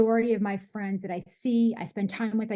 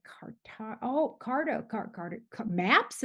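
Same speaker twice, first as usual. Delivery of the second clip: halting, stumbling over an unfamiliar word; imitated puzzlement; slower than usual. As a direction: sound out a word in fragments, then guess. Imitates dad puzzling out cartography.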